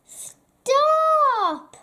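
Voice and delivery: sudden high, loud cry of stop; cried out. Acted. Bertie the goat cries out to the troll, sudden high loud cry. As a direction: shout it to halt the troll in his tracks. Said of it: "cried Bertie".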